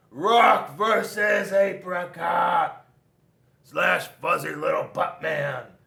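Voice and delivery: loud, slow battle cry; battle yell. A narrator's reading. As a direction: shout the matchup like an announcer. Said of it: "jumped into the air and yelled".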